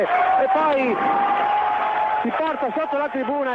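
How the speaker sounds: excited goal call; goal shout. Real, not acted. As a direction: roar the goal.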